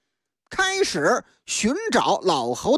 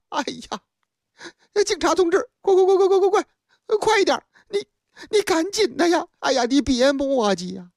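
high, urgent, tearful begging; a panicked father hurrying the police along; frantic plea; 6 semitones higher, faster than usual. Acted. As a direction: rush the officer, near tears.

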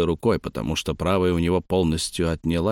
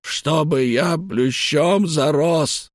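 put-on a high, slow, furious old man's cry; an old man suddenly bursting out in rage; angry old man outburst; 8 semitones higher, much slower than usual. A narrator's reading. Старик стучит рукой по перилам. (старик вскрикивает в гневе)

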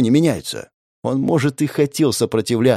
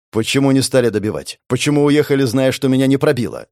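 plain reading voice

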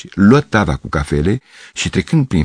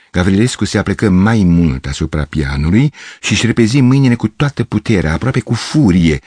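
ordinary delivery, nothing marked

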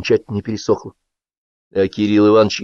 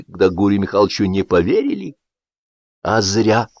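plain reading voice

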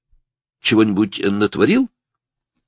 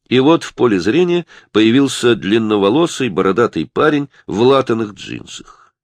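ordinary delivery

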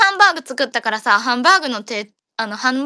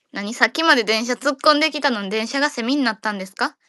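ordinary delivery

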